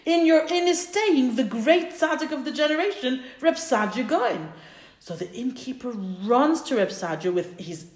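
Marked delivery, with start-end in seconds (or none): 0.1-4.5 raised pitch, building to the great name; awed announcement. Retold or imitated. Townspeople tell innkeeper who his guest is, awed announcement. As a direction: announce it as astonishing news.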